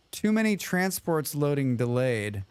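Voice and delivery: ordinary delivery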